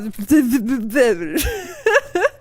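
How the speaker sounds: sputtered nonsense syllables breaking into laughter; garbled tongue-tied sputter. Shown as a demonstration. Streamer showing how the title comes out, garbled. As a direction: mimic your own tongue-tangle, then crack up. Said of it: "it just comes out".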